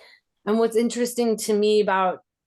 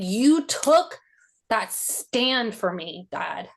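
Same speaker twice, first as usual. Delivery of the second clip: ordinary talk, nothing marked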